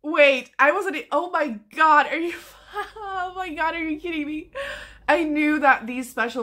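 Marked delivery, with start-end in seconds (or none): 0.0-4.5 high, half-crying with joy; overjoyed, close to tears; tearful delight. Real, not acted. Vlogger uncovering the pages, crying with joy. Sees special edges.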